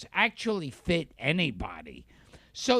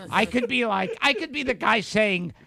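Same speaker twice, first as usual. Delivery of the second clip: ordinary talk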